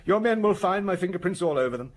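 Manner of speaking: high, loud, indignant protest; exasperated outburst. Acted. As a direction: protest loudly, pitched well up. Suspect's exasperated outburst under questioning.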